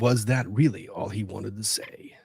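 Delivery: whispered documentary narrator; breathy hush like a wildlife narrator; hushed nature documentary. Pretend. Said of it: "can you give me your best narrator voice".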